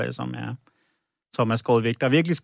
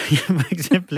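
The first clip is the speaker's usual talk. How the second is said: laughing; 5 semitones higher than usual. Real, not acted. Laughs at the joke about the name.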